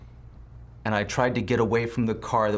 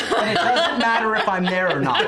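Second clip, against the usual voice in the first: high, loud, laughing through it; can't stop laughing; 11 semitones higher, 6 dB louder than usual. Real, not acted. Laughing about the fumble rule.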